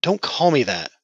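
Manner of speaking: muffled under a blanket. Acted. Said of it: "blanket-muffled voice".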